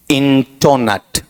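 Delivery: इंटोनट slowly as "in-to-nut"; इंटोनट drawn out, ending "nut"; wrong pronunciation. Shown as a demonstration. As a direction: put a round o in the middle of इंटोनट. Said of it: "ऐसा नहीं होगा".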